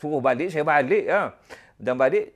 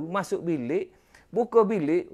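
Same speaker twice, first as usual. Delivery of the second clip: normal talking voice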